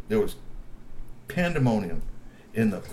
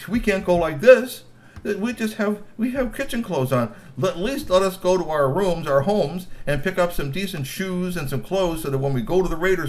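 higher pleading Grassy voice; pleading troll; 8 semitones higher, 3 dB louder than usual. Acted. Grassy pleading with guards for shoes, higher voice. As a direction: bargain with the guards, hurried.

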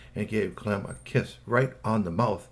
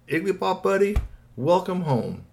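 lightly voiced hearty buddy, pitched higher; Clem's friendly greeting; 7 semitones higher than usual. A narrator's reading. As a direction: welcome a pal in a raised voice. Clem greets his alien buddy.

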